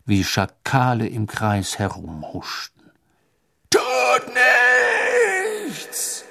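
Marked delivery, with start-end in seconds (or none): 3.7-6.2 high-pitched shriek of command; Voldemort shrieking. A narrator's reading. Voldemort orders the Death Eaters to hold back, shrieking. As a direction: screech the order, drawn out and trailing away. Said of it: "kreischte Voldemort den Todessern zu".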